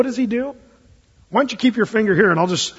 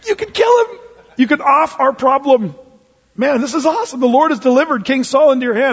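eager soldiers; 6 semitones higher than usual. Retold or imitated. David's men urging him to strike the sleeping Saul, excited. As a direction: egg him on to kill the king. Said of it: "his guys are saying".

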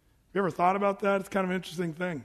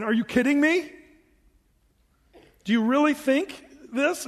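higher, disbelieving "Are you kidding me?" with a laugh; incredulous rebuke; 6 semitones higher, 3 dB louder than usual. Retold or imitated. Preacher voicing Paul's disbelief at hypocrites, incredulous. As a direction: scoff at the very idea. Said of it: "This is Paul saying".

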